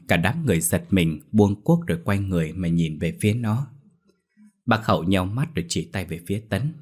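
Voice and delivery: ordinary delivery, nothing marked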